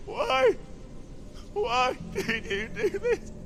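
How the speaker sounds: high, strained wail; anguished grief. Acted. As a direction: cry it out in grief. Grieving over brother.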